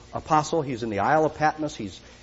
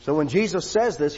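ordinary talk

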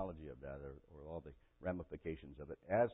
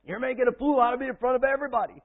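very loud, high-pitched indignant yell; hammed-up furious shout, far above normal pitch; retold inner outrage; 18 dB louder than usual. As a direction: shout the silent protest as if humiliated. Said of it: "I'm sitting there thinking".

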